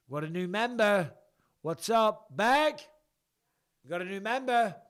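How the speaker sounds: loud, high call-out; excited announcement. Real, not acted. Host greeting a new member, loud excited shout. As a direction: holler a big welcome.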